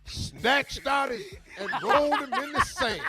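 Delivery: high, loud, laughing recital. Retold or imitated. Recalling the record line for friends, laughing and high. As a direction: belt the rhyme through a laugh. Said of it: "I'm doing my [name]".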